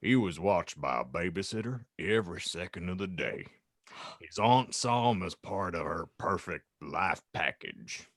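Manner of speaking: low, slowing character voice; mock-solemn low disapproval; concerned Hagrid. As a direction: list the grievances in a deep, grave voice. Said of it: "Hagrid declared concernedly".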